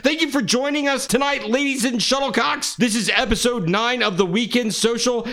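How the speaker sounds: booming hype-man intro; big show announcer. Acted. Podcast host hype intro.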